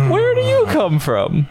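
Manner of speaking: high, slow sweet voice. Acted. High-pitched questioning of creature.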